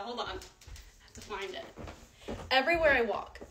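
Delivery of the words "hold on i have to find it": whisper. Real, not acted. Hunting a squeaky floorboard quietly.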